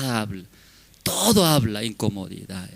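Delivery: ordinary delivery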